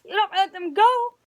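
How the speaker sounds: high loud disbelief; can't believe it; aghast. A narrator's reading. Captain aghast.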